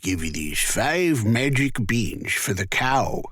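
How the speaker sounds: a touch of a sly stranger's voice; strange man. A narrator's reading. Strange man offering beans to Jack, lightly voiced. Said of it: "said the strange man".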